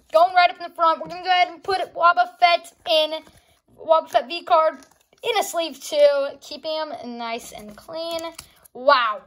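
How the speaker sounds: ordinary delivery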